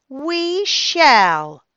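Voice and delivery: put-on child parroting the grandmother's manners; mock prim reply. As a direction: echo grandma's posh words back. Child mimicking Nana's phrasing.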